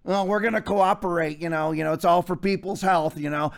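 soft, low mocking of cowards; mocking whiny voice. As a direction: sneer at the compliant pastors. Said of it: "rest of you clowns".

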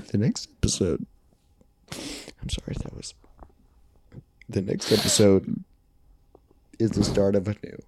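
soft, halting fake-grave announcement; mock solemn. Hosts teasing listeners with bad news, softly and halting. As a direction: act like breaking tragic news.

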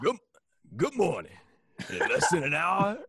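loud, high rousing speech voice; rally the troops like a gung-ho general; Al Pacino. Pretend. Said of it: "That was Al Pacino".

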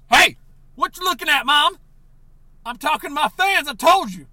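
loud, high shout toward the door; yelling at mom. Acted. Character yells at his mother at the front door, high and loud. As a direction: bark back at the nosy mother.

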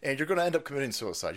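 ordinary talk, nothing marked

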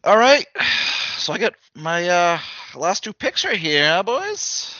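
high, upbeat show-host voice; announcer. Acted. Draft host announcing picks.

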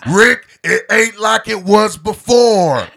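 slow, loud, high Texan wail; hammed-up Texas accent. Pleading with Rick in a TV quote, hammed-up Texan. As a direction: stretch every word in a loud mock Texas drawl. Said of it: "talking like I'm from Texas".

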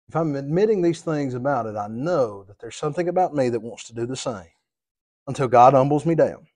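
normal talking voice

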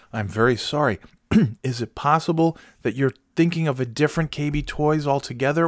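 ordinary talk, nothing marked

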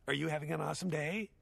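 mock-chirpy customer-service greeting; counter girl. Girl behind the counter greets a customer, mock chirpy. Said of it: "the girl behind the counter said".

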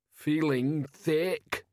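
slow, dull troll voice; troll mutter. Acted. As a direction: drag it out like a dazed troll. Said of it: "he muttered".

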